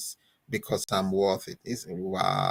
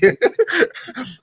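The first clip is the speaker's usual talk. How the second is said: high, loud burst of laughter; big laugh; 8 dB louder than usual. Real, not acted. Host cracks up at the tall building joke, a loud high laugh. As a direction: crack up at your own joke.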